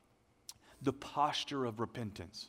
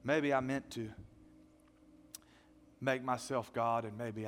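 repentant prayer; 4 semitones lower than usual. Acted. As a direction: pray it low and tender, with sorrow. Prays a model repentance prayer.

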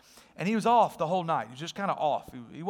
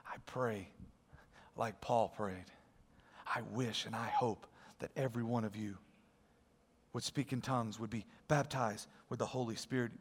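soft sincere wish; 8 semitones lower, 10 dB quieter than usual. Real, not acted. Quiet heartfelt prayerful wish.

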